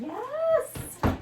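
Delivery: high joyful exclamation; delighted squeal. Real, not acted. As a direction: squeal with joy. Delighted with the books.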